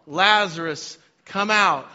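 high, loud summoning call; Jesus shouting to Lazarus to come out. A narrator's reading. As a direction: call him out of the grave. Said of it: "Jesus called in a loud voice".

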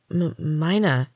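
slow, hesitant stammer on the name; flustered stutter with a tentative finish. A narrator's reading. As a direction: stumble on the first sound, then say it. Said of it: "I stammered".

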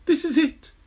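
high, doomed this is it; high panicked voice. A narrator's reading. Henry falling fast, sure of doom. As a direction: squeak it out in panic.

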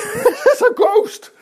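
high, slow ghost scream broken by laughter; laughing mock shriek of a ghost sighting; mock terrified scream. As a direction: ham up the disciples' terror while laughing. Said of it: "they screamed in terror".

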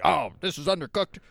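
imitating a demanding diner; mock demanding. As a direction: act out an indignant customer. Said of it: "don't demand that your meal be free".

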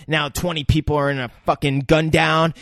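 ordinary talk, nothing marked

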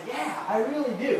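a kid who badly wants in; eager boy. Ragged boy answering the preacher, a hint of an eager child voice.

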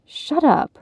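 high-pitched irritated retort; agitated snap. A narrator's reading. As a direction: snap back at the nagging thought. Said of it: "Sam snapped".

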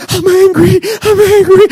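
high-pitched mock crying wail; crying angry. Pastor imitates coworker crying in the hallway, high tearful whine. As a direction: squeal it through put-on tears. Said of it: "she's crying".

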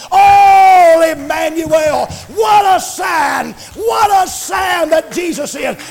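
loud shouted gospel exhortation; preaching at full force. Real, not acted. Preacher telling folks not to be down, shouting. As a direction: lift them up, shouting.

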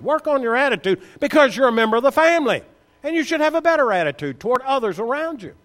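high, loud, rising sermon rebuke; preaching at full force. Acted. Preacher challenging church members over their attitude, high and loud. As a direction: hammer the questions at them, climbing.

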